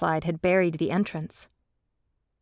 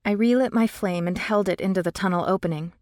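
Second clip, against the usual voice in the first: ordinary delivery, nothing marked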